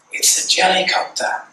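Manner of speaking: put-on high, whispery small-kid voice; hushed kiddie voice on the phone; little child. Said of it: "And the little voice said".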